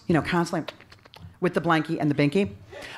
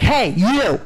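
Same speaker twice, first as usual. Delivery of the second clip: imitated accusing call, a bit louder; mildly hammed-up yell; mock yelling; 4 dB louder than usual. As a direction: call them out like an angry older kid. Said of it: "She starts yelling at me".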